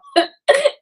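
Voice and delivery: high burst of laughter. Real, not acted. Laughs at own punchline.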